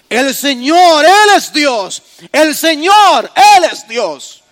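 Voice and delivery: loud, slow acclamation; proclaiming loudly. Acted. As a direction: declare it like a crowd in awe. El pueblo postrado proclama. (proclamación fuerte y lenta)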